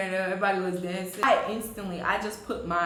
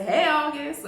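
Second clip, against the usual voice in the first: put-on higher country-accented greeting; 7 semitones higher, 4 dB louder, slower than usual. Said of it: "in my country accent".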